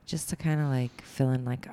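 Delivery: usual speaking voice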